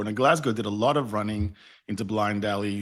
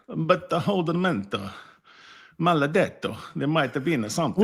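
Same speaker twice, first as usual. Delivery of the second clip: hammed-up Italian accent, pitched higher; mock Italian accent; 6 semitones higher than usual. Italian player sweet-talking the Italian ref, mock Italian accent. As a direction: play an Italian player pleading in a fake Italian accent. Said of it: "in Italian accent".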